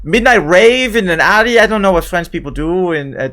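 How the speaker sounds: put-on French accent drifting toward German. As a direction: play her with a wobbly French accent. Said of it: "You can tell from my accent that I'm French".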